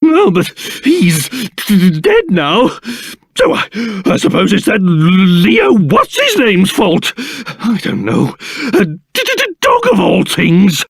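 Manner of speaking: teeth-chattering, stuttering complaint; shivering with cold. Acted. King grumbling in a freezing place, shivering and stuttering. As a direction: shudder and stammer through the freezing cold. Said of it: "I don't think I've ever been so c-, cold".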